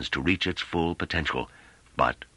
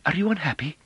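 high-pitched, tentative question; meek, high character voice; timorous woman; 11 semitones higher than usual. A narrator's reading. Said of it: "in a timorous manner".

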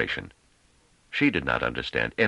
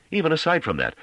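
sudden high, louder burst; startled realization, pitched up; 12 semitones higher, 4 dB louder than usual. A narrator's reading. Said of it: "he seemed startled by a sudden revelation".

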